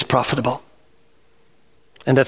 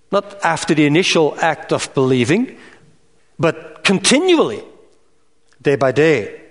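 normal talking voice

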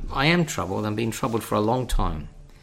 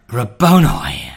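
louder, slow cry of recognition; joyful cry; 8 dB louder, slower than usual. Retold or imitated. Mary recognising the risen Lord, cries out in joy. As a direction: call his name in sudden joy. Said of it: "She would have gasped, you know".

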